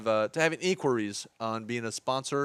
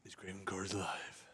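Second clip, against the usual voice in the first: deep, softer movie trailer voice; low dramatic trailer announcer; 6 semitones lower, 17 dB quieter than usual. Acted. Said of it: "that's my trailer voice".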